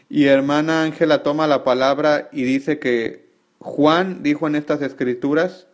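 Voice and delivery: ordinary talk, nothing marked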